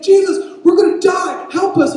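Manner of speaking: very high, full-volume cry for help; acted panic at its peak; desperate scream. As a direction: scream it so he finally hears. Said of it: "I didn't quite hear that".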